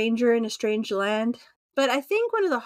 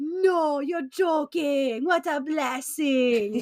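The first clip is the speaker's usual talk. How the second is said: amazed stranger; 3 semitones higher, much slower than usual. Retold or imitated. South African stranger hearing it's twins, slow amazed delight. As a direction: draw it out in happy disbelief.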